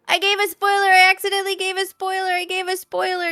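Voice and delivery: high, loud panicked blurting. Real, not acted. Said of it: "with my panic".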